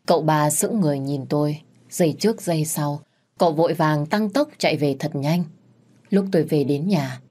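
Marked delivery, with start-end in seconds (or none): none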